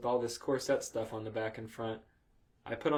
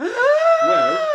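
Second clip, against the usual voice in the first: very high, loud mock call; hammed-up shout; 15 dB louder than usual. Reading the fanfic boy's line, shouted. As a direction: belt it out absurdly.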